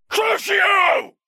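high, loud cry of a spell; shouted curse. Acted. Sirius casting a torture curse, shouting. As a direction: shout the curse at full force.

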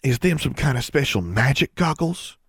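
put-on high, eager country girl asking; a thrilled kid peppering questions; keen high girl voice; excited. A narrator's reading. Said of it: "She sounded excited".

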